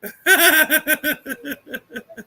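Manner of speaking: long high rapid laugh, fading away; high laughing fit. Real, not acted. Host amused by the kids, high laughing fit. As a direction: crack up high-pitched until the breath runs out. Laughs after his shizzle line.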